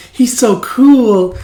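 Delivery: high, slow swooning; dreamy swoon. Retold or imitated. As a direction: sigh it out smitten. Smitten coworker swooning.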